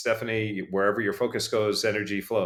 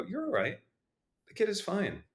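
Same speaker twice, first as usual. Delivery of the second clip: reassuring parent; 10 semitones higher, 6 dB quieter than usual. Retold or imitated. Calm parent reassuring fallen kid.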